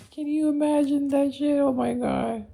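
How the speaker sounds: very high squeaky disbelief; high amused squeal. Real, not acted. Streamer reacting to their own audition joke, high squeal. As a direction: squeal it just after a laughing fit.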